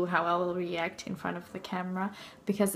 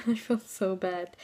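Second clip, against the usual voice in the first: usual speaking voice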